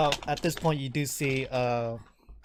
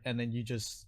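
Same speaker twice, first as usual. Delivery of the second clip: normal talking voice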